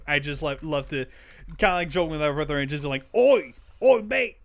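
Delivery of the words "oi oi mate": high, loud British "oi" holler; mock British accent. Joking with a brother, loud mock British accent. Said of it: "almost sounds like a British accent".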